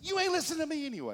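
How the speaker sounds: loud, high, rapid accusation; indignant outburst. Acted. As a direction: fire it off in mock indignation. Voices what he wanted to say.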